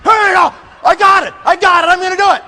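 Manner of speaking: high, loud fired-up shouting; all pumped up. Acted. Recruit answers the pep talk, acted pumped-up shout. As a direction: shout it like an over-hyped recruit. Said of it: "Guys all pumped up".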